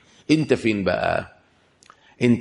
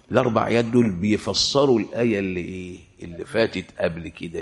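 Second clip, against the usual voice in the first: ordinary delivery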